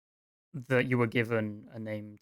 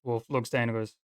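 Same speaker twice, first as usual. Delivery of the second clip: normal talking voice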